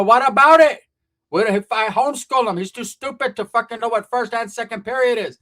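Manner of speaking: loud, high outburst, easing into a rant; mock-furious aunt brushing off objections; exasperated aunt. Aunt dismissing worries about her son's schooling, exasperated.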